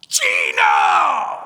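deep, loud shout of a name; retold shout. Demonic voice calling the preacher's name, deep loud shout. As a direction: bellow the name from down the hall. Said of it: "I hear something else".

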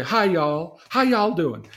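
chirpy Southern belle hello to classmates; Southern accent. Retold or imitated. Girl greeting classmates in a Southern accent. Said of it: "I even did the accent".

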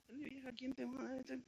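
high, soft mocking aside to the wife; a hushed, teasing rebuke; mock scolding husband. Adam scolds Eve, aside.

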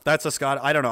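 ordinary delivery

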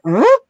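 high, quick imitated scratch; a high vocal record-scratch whoop; record scratch noise. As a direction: mimic a record screeching to a stop. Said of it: "that scratch record sound that you hear".

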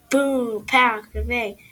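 loud comic-book bangs; shouted sound effects. Acted. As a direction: shout them like punches landing.

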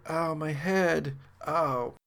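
slow, soft, dazed moaning; dazed groan. Acted. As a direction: moan it, head still ringing.

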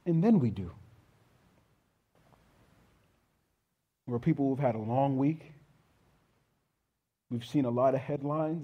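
earnest pulpit prayer, slowing on key words. Acted. Pastor praying over the congregation, earnest prayer. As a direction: pray over the congregation, building then softening.

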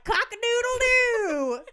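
loud, high, slow crow; rooster call. Retold or imitated. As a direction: scream it like a rooster in the street. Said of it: "we were like".

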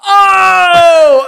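long, very high, loud "oh"; group jeer shout. Retold or imitated. Driver imitating group in next car, long high yell. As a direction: imitate a carful of hecklers yelling. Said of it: "like, group go".

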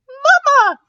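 put-on high, loud baby chirp; little one's high-pitched chirp; tiny chirping voice. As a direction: chirp it like a tiny child calling out.

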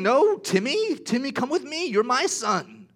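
high, loud urgent parent's call. Pretend. Parent calling loudly to win back their child. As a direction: pull your child back from the stranger.

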